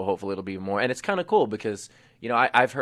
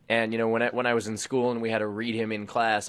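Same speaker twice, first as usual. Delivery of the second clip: ordinary delivery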